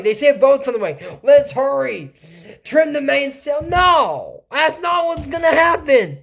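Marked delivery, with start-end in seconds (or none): 3.6-6.2 exasperated. Real, not acted. Exasperated rant at the show.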